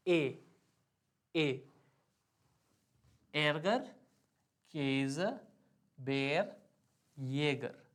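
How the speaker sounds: ए as "eh", held in एरगर, केज, बेर, येगर; A umlaut sound. Shown as a demonstration. Teacher drilling A umlaut examples, measured word list. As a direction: separate words with a long open ए vowel. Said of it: "इसका pronunciation है".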